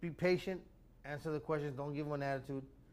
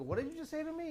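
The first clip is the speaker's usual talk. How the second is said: high-pitched, incredulous comeback at usual volume; retold indignant challenge; 12 semitones higher than usual. Driver challenging the cop's insult, retold indignant high voice. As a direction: imitate an affronted double-take, pitched way up. Retelling his challenge to the cop.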